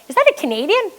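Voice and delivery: high-pitched astonished question, rising at the end; surprised question. Retold or imitated. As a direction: act out a bystander's startled question. Bystander spots her flag, asks.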